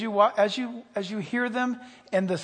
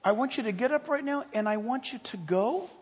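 high, pleading concern; acted urgent, caring questioning, pitched up; God's loving appeal; 7 semitones higher than usual. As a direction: plead tenderly with someone who is hurting. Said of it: "and he, and he says".

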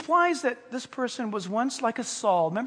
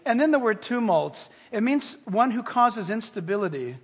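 ordinary talk

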